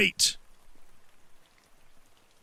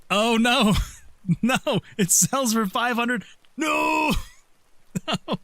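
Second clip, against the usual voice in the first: dismayed; 7 semitones higher, slower than usual. Real, not acted. Regret after selling item.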